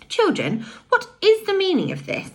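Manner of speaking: high, astonished aunt; surprised question. A narrator's reading. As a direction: ask in utter surprise. Asked Aunt Fanny.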